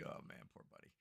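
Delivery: near-whispered sympathy for the sick little one; soft pity. Retold or imitated. Dad softly pitying his sick son, hushed whisper. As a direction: breathe it out gently, almost voiceless. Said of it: "I'm like".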